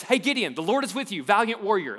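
loud, high and quick, all run together; fast and loud. Shown as a demonstration. Preacher quoting the Lord's greeting, loud and quick. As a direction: blurt it out in one breath. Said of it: "Let's take it a little bit slower".

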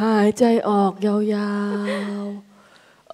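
very slow drawn-out breathing cue; slow breathing guide. Shown as a demonstration. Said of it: "หายใจเข้า".

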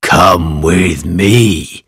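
low, slow sly wolf voice. Acted. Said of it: "said the wolf to the goat".

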